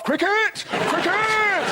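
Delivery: very high mock shriek; blurting Telegraph. Telegraph has its turn, high mad cry. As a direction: shriek the one word like a battle cry. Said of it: "Tell 'em, Telegraph".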